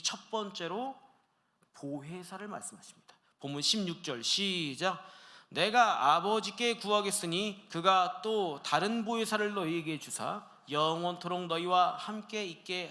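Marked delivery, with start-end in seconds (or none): 5.5-12.9 slow, deliberate reading of the verse; scripture reading. Acted. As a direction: read the Bible text with weight. Reads scripture passage aloud. (성경 본문을 천천히 낭독)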